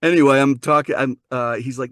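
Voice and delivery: ordinary delivery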